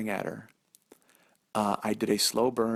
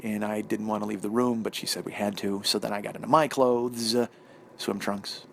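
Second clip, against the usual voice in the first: usual speaking voice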